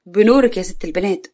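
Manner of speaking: plain reading voice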